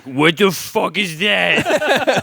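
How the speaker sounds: slow, high, baffled cop; dumb cop voice. Retold or imitated. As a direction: drawl it like a clueless cop.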